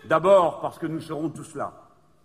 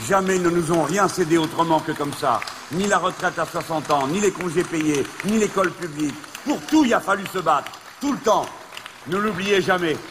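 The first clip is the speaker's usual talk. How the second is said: fiery rally oration, shouted high; ranting dictator speech; 5 semitones higher, 6 dB louder than usual. Real, not acted. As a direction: roar it to the crowd. Rally speech at full force.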